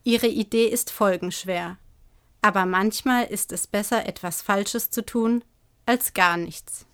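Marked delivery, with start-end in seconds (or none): none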